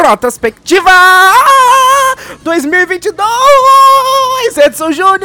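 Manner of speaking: dramatic announcer. Acted. Dramatic show title announcement.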